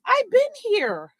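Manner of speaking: stretched, high mock of the short date; slow, high date voice. Imitating her date insisting he's already there, slow and high.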